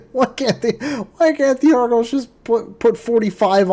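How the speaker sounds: real fan exasperation; exasperated plea. Real, not acted. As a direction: complain in mock despair.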